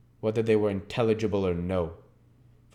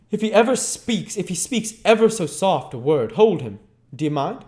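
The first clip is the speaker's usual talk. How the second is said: much higher, louder menace; menacing thug; 10 semitones higher, 7 dB louder than usual. Acted. Sikes warns Oliver the dog will hold him, menacing.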